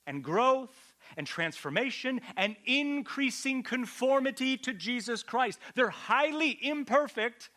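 high, loud, rising sermon climax; full-force preaching. Acted. Pastor drives the point home to the church, preaching at full force. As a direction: build it up like a preacher at full pitch.

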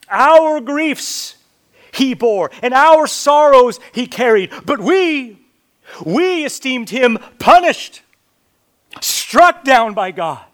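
loud and high, hammering each phrase; preaching at full force. Acted. Preacher proclaiming Isaiah's verse at full volume. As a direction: cry the verse out over the crowd. Third reading of verse four.

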